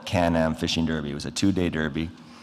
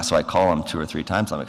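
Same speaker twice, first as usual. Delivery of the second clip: ordinary delivery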